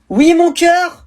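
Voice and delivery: mother answering. Acted. Mother voiced answering child.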